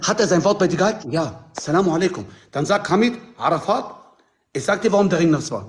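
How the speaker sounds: ordinary talk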